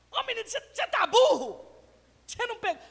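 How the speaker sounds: impatient parent snapping during homework; berating parent. Acted. Parent berating child over homework.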